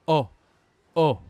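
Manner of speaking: O said open and short, twice; open O sound. Shown as a demonstration. Teacher isolates the vowel for learners, punched open O. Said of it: "c'est le son".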